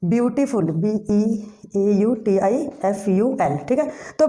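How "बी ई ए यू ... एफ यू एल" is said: ordinary delivery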